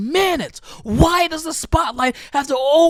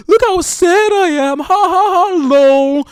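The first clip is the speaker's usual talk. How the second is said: louder, sarcastic fake-sad voice with a put-on laugh; mocking fake sadness; 5 semitones higher, 6 dB louder than usual. Mocking people who post sadness online for likes, sarcastic. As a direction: sneer at sadness posted online. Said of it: "on for the gram and all the TikToks to say".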